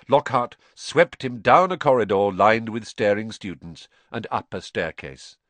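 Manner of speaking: ordinary delivery, nothing marked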